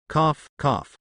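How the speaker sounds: forced little cough; fake cough. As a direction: clear throat pointedly. Fake cough to change subject.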